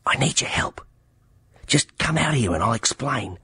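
whispered urgently, voice dropped to breath; urgent night whisper. A narrator's reading. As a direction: hiss it through the window so no one wakes. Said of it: "he whispered".

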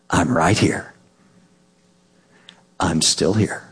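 low whispered reassurance; soothing whisper. Pretend. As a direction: whisper to calm a frightened child. Parent soothes child in dark.

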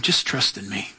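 very low, soft divine reassurance; still small voice. Acted. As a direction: speak as God, hushed and deep. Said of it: "It's that still, small voice".

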